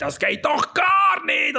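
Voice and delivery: high, slow furious shout; a councillor's outraged cry, slow and high. Acted. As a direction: yell it like you're kicking over a chair. Council member jumps up, smashes chair.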